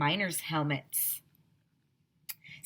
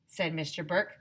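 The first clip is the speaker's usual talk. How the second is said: plain reading voice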